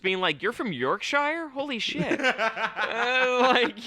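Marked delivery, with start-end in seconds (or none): 0.4-2.2 high, laughing mock amazement; amazed. Someone gawking at a goblin, high laughing mock disbelief. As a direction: gasp it out in disbelief.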